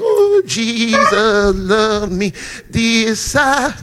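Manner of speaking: slow, mock-pious singsong of a deep believer; mocking deep churchgoer. Preacher mimicking deep churchgoers, a slow mock-pious drawl. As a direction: lightly parody the overly deep ones. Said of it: "This how they talk to y'all".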